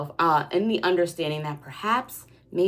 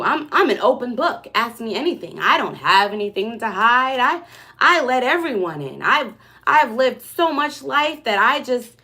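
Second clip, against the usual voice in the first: higher and louder, a hammed-up bragging guarded person; mock open-book persona; 6 semitones higher, 6 dB louder than usual. Reader imitating a guarded person who claims to be an open book, lightly mocked. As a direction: play someone who swears they hide nothing, lightly mocking. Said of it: "And I'm not mocking anybody".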